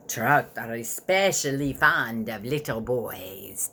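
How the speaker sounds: slow, low, breathy vampire whisper; evil vampire whisper. A narrator's reading. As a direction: hiss it slowly like a hungry vampire. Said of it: "Dad said in his evil vampire voice".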